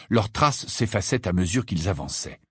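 ordinary delivery, nothing marked

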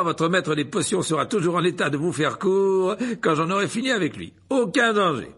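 high, loud, self-satisfied lecturer voice; pompous wizard boasting to the hall; vain showman professor. A narrator's reading. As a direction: preen before the students. Lockhart's boastful speech.

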